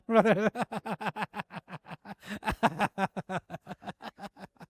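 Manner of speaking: drawn-out rattling laugh after a joke; amused, sustained laughter; long chuckle. Real, not acted. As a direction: laugh it out, tailing off.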